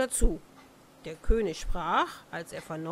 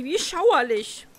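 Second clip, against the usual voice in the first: shuddering; 5 semitones higher, 5 dB louder than usual. A narrator's reading. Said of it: "dachte sie".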